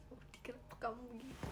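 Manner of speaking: hushed, pretend-wounded question; soft mock lament. Viewer asking Woody why he acted so, softly plaintive. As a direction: softly plead with the character, half whispered.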